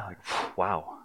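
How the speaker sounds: hushed, breathy wow; a soft amazed wow; quietly stunned Indy; awed wow. Acted. Indy looking over cliff.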